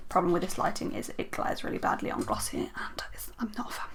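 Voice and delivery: hushed, breathy murmur; muttering to herself while adjusting the light; whispered aside. Real, not acted. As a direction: drop to a soft whisper.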